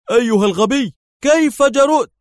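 high, loud outraged cry; furious. A narrator's reading. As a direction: explode at a rival, pitch soaring. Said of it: "وهتف محنقا".

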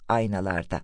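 ordinary delivery, nothing marked

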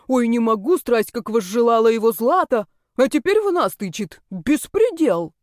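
indignant scolding. Acted. Heroine rebukes the girl who blamed her, indignant scolding. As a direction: scold with mocking outrage. Heroine quarrels back, hands on hips.

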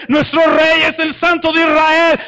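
loud, high war cry; grito de guerra. Shown as a demonstration. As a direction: shout it like an army charging. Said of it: "empieza a subir el grito de guerra". (grito de guerra fuerte y agudo)

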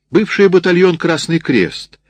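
high, loud, slowing proclamation; calling out. A narrator's reading. Canteen woman explaining the battalion's former name, calling out proudly.

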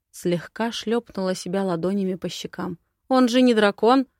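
plain reading voice

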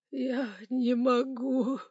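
slow, high weepy whine; drawn-out tearful protest. A narrator's reading. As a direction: plead helplessly through tears. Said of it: "По щекам управляющего покатились слёзы".

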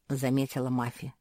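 ordinary delivery

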